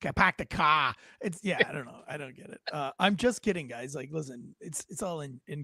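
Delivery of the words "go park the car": put-on Boston accent, r's dropped. Host echoing co-host's imitation, mock Boston accent. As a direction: imitate a Bostonian with broad open vowels. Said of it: "than a Boston accent".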